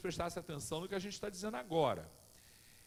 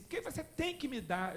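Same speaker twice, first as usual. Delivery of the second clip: put-on demanding boy; 9 semitones higher than usual. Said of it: "Aí ele diz assim".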